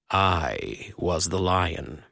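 low, slow and grave; booming male voice. A narrator's reading. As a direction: reveal it slowly, deep and calm. The voice reveals itself.

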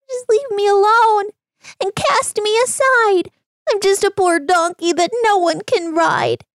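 high, pitiful little donkey voice; sad donkey. Acted. Donkey pleading with the man in a high sad voice. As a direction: whimper it out as a sorry little donkey. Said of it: "The sad donkey cried".

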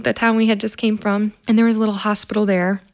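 usual speaking voice

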